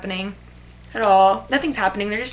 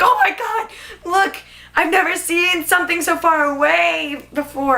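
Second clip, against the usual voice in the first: high-pitched excited retelling of awe; amazed flyer; 7 semitones higher, 3 dB louder than usual. Retold or imitated. Gus looks out the plane window, amazed and excited. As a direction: mimic his wide-eyed amazement, pitched up.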